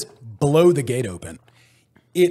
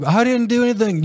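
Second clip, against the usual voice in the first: high, loud mock protest of innocence; defensive protest; 7 semitones higher, 4 dB louder than usual. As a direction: plead you did nothing. Protesting during the chewing out.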